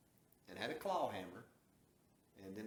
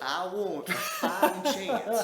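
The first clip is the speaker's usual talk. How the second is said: laughing demand; 10 semitones higher, 16 dB louder, much slower than usual. Retold or imitated. Retold demand, laughing while saying it.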